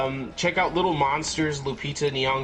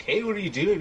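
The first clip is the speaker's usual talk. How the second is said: higher, lightly voiced girl; 6 semitones higher, faster than usual. A hint of the girl asking Dale what he's doing. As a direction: ask it sweetly as the girl. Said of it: "she's like".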